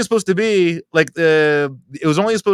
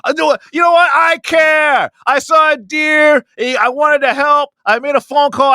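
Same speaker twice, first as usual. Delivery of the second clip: indignant shout; 7 semitones higher than usual. Real, not acted. Defending calling animal control to mocking friends, indignant shout. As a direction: yell it like you're fed up defending yourself.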